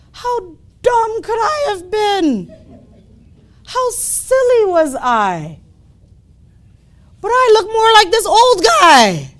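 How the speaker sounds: high, loud, drawn-out self-mockery; astonished realization. Cub seeing his reflection, amazed realization.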